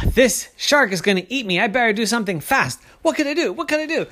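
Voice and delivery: high, panicky rush rising as it goes; scared. Acted. Kenny thinks the shark will eat him, scared panic. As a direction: work up into a high acted panic. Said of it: "got scared and started to tremble".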